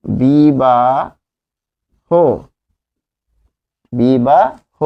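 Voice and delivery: বিবাহ first as "biba", then "bibah" with the h sounded; teacher repeating বিবাহ deliberately; slow model word. Shown as a demonstration. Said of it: "তাহলে যখন একে লিখব".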